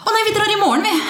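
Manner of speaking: mock sweet reply. Acting their sweet reply to boss.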